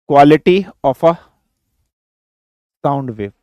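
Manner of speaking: quality of a sound wave spelled out slowly with pauses; slow, spaced-out dictation. Shown as a demonstration. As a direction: dictate it slowly so students can write. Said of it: "third factor लिखिए".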